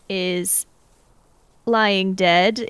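ordinary delivery

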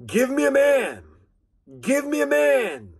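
high ringing challenge call; crying out. Acted. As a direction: call it out like Goliath taunting an army. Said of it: "that giant voice crying out".

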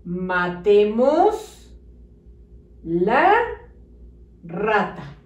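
"Matemos" stretched, then "la", then "rata", separated by pauses; slow spaced dictation. Shown as a demonstration. Teacher dictating the sentence for children to copy. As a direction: dictate slowly word by word. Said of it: "Debe de decir".

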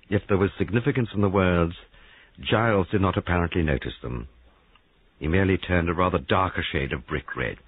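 usual speaking voice

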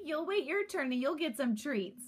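usual speaking voice

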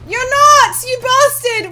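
high, loud outraged yell; furious shout. Real, not acted. Player yelling at a zombie, furious. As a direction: scream it at the zombie. Last zombie escapes, rage.

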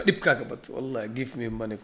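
ordinary talk, nothing marked

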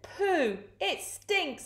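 high, disgusted storybook crook; mock disgust. A narrator's reading. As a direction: recoil from a stink in a high voice. Digger disgusted by the smell.

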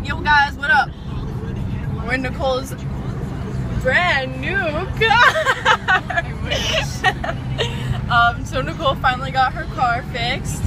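loud hyped greeting; shouting with excitement; excited. Real, not acted. Excited yelling in car.

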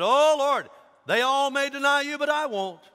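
loud, high protesting vow; hammed-up boastful pledge; Peter. Disciple vowing loyalty to his Lord, loud protest.

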